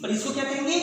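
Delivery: ordinary delivery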